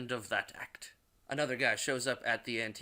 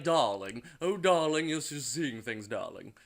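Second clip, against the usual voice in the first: hammed-up theatrical "darling" voice; dismissive wife; 4 dB louder than usual. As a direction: start loud and gushing, then let it fall away.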